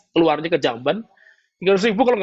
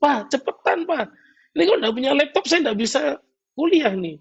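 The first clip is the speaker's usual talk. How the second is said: put-on higher, pleading young voice, slowing at the end; impatient child; 9 semitones higher than usual. Son pushes his father for a laptop, higher and pleading. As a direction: play the child nagging for the laptop. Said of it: "anaknya nanya lagi".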